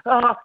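loud, high "ah" of someone stuck; frozen panicked stammer sound. Acted. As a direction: stall with a helpless loud moan. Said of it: "rocking back and forth".

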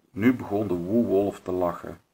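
plain reading voice

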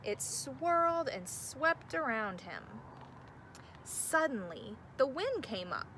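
gentle storybook narration; reading to children. A narrator's reading. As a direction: read it like bedtime story time. Reads picture book aloud.

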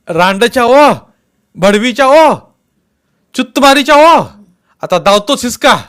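very loud, very high screaming abuse; yelling curses. Acted. Beaten men cursing their attackers, furious yells. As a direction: scream the insults while beaten. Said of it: "ओरडता ओरडता".

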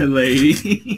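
slow, courtly hat-tip greeting; gallant gentleman. Retold or imitated. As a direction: draw it out like a suave cowboy. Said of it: "tips his hat like this".

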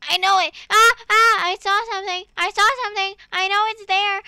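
loud, high, thrilled cries of discovery; excited shouting. Real, not acted. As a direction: yell it out like you just found treasure. Spotting something exciting in game.

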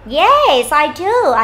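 "Yes" high, loud and drawn out, then "I do"; emphatic yes. Shown as a demonstration. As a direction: hit "Yes" hard with glee. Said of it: "chúng ta nhấn mạnh này".

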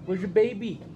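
usual speaking voice

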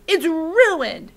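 high, loud wail of outrage; screaming. Acted. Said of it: "Torin screamed".

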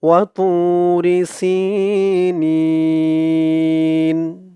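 ওয়া তু রি সি নিন with নি held three alif; drawn-out final. Shown as a demonstration. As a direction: stretch the last vowel long before stopping. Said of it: "এখানে ওয়াকফ করার কারণে". (নিন-এ তিন আলিফ টান)

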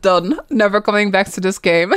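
normal talking voice

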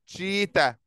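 "شيتا" said as cheetah, ending on an open a with no r; ending in a, not r. Shown as a demonstration. Teacher models cheetah for pupils, ending in a. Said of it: "أكنها أ، مش أو".

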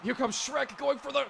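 high, very loud play-by-play; frantic ringside yell; excited commentary. Real, not acted. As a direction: shout the finishing move as it happens. Calling a finishing move live.

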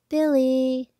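loud high call to a friend; excited greeting yell. Acted. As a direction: shout his name with joy. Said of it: "yelled Arthur".

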